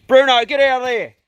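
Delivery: loud call to the dog; yelling at dog. Real, not acted. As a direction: shout him off the feed. Calling the dog off feed.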